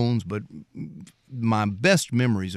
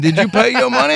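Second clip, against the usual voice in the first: imitating dad; 13 semitones higher, 7 dB louder than usual. Dad voiced in retold story.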